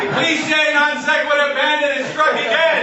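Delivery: high, hammed-up victim's yell; mock-outraged cry. Car owner cursing the cliche bandit, mock outrage.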